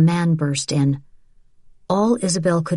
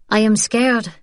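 understated fear, higher-pitched; frightened admission; 5 semitones higher than usual. A narrator's reading. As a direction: confess it in a higher voice. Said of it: "Isabel admitted".

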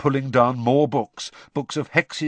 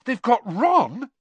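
shocked; 11 semitones higher, 4 dB louder, slower than usual. Acted. Harry shocked.

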